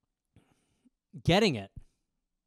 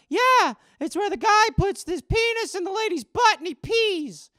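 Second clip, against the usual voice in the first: put-on high, loud, confident little boy; young kid voice; 12 semitones higher, 5 dB louder than usual. Boy answering his dad, high and sure. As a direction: chirp it out like a proud child.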